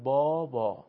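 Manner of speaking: بابا with the long drawn â; long a demo. Shown as a demonstration. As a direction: stretch the â in بابا clearly. (بابا با الف مدی کشیده)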